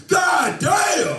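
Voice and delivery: high, loud "God damn!" shouted; announcer yell. Retold or imitated. Stadium announcer reacting to the dropped pass, loud high yell.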